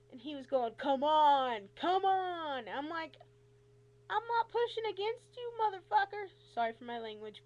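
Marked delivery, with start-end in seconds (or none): 0.8-2.7 loud, high, pushy repetition; impatient urging. Retold or imitated. Doctor pushing on patient's foot, loud and impatient. 4.1-6.3 high, loud, exasperated retort; angry protest. Retold or imitated. Patient protesting to the pushy doctor, high and angry.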